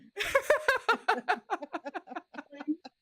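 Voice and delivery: loud, high burst of laughter. Real, not acted. Friend cracking up at the joke, loud high laugh.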